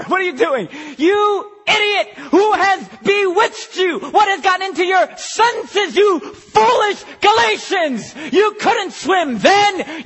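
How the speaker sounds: full-force high shout, heavily punched words; exasperated scolding. Acted. Rescuer berating man who can't swim, exasperated shouting. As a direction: yell it at him in furious disbelief. Acted scolding of the foolish swimmer.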